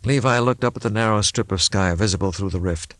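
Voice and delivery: ordinary delivery, nothing marked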